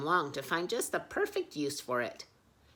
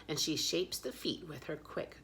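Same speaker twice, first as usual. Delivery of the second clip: ordinary delivery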